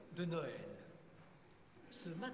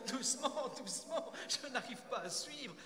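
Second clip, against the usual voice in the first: flustered; 7 semitones higher, 4 dB louder than usual. Acted. Nathan unable to follow the shepherd's list, flustered. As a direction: beg for a pause, flustered. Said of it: "commence à s'arracher les cheveux".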